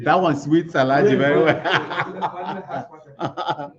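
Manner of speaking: slow words through a laugh; words melting into laughter. Real, not acted. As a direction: chuckle the line out slowly.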